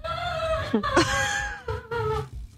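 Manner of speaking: very high screaming laughter; shrieking laugh. Real, not acted.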